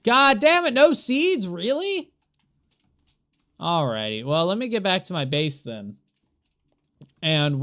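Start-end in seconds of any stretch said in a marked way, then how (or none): 0.0-2.1 much higher, loud exasperated cry; frustrated outburst. Real, not acted. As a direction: explode in disbelief. No seeds dropped.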